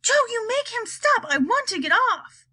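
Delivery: high-pitched panicky grandma yell; frantic high-pitched demand; yelled plea. A narrator's reading. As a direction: yell up high, pleading to be let off. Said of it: "yelled Grandma Josephine".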